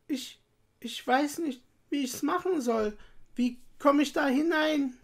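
put-on high, hesitant girl's voice; helpless little girl. A narrator's reading. Gretel pretending not to understand the witch, helpless. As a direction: play innocent and unsure, pitched high.

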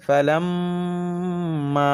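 ফালাম্মা chanted with a long hummed "mm"; very slow, sustained ফালাম্মা on one note; held ghunnah and madd. Shown as a demonstration. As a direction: hum the doubled m of ফালাম্মা, then hold "maa". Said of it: "মধ্যে মুনফাসিল তিন আলিফ".

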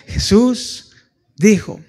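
high, loud cry of the name; preacher's ringing call; proclaiming. Real, not acted. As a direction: lift the name out to the church.

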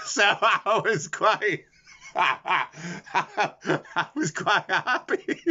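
slow, drawn out through giggles; laughing too hard to finish the line; wheezing laughter over the words. Real, not acted. Laughing at his own joke.

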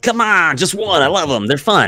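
high-pitched, whiny plea falling on each phrase; pleading riddle-lover. Acted. Game master's troglodyte begging players for one riddle, pleading. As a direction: beg eagerly in a high character voice.

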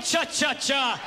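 slow hissed ch-ch-ch; slow breathy cha-cha-cha; creepy whisper chant. Retold or imitated. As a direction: breathe it like the Jason sound. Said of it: "You hear that?".

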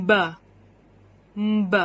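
"b" as its phonics sound, buh; letter sound b. Shown as a demonstration. Teacher sounds out the letter for children, letter sound b. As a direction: say "b" as a short isolated consonant sound. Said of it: "ที่ให้เสียง".

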